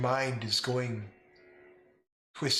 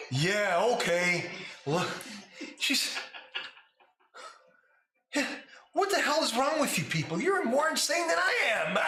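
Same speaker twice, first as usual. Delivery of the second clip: pissed off; 11 semitones higher than usual. Acted. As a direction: rant at them as if they're crazy. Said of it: "very pissed off".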